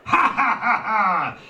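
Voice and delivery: big, high, acted belly laugh; loud mocking laugh. A narrator's reading. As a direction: laugh loudly at your son, each ha punched. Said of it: "Cade's father laughed".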